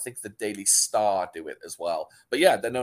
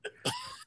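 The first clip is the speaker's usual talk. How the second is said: short laugh; 8 dB quieter than usual. Real, not acted. Sudden laugh at joke.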